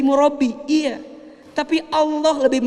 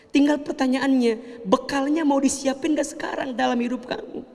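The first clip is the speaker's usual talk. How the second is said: usual speaking voice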